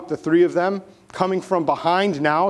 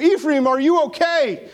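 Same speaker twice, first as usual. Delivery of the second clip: mild yell; 9 semitones higher, 3 dB louder than usual. Acted. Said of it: "they yell out to Ephraim".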